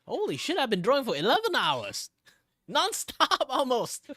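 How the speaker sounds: loud, high shocked outburst; real astonishment, laughing at the end; real surprise. Real, not acted. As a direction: blurt it out in disbelief. Shocked at eleven hours drawing.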